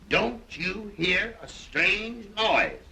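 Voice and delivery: slow, high, drawn-out question; theatrical cue. Shown as a demonstration. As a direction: stretch each word out as the signal line. Repeats cue line for Donald.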